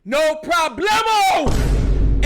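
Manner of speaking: slow, loud and high, stretched out; drawn-out triumphant shout; shouted no problemo. Retold or imitated. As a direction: bellow it like a victory cry. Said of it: "I said".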